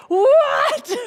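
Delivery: shrieking high, loud, with a laugh; hammed-up shocked squeal; mock outraged child. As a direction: play the kid stunned at losing the tablet. Child told no tablet today.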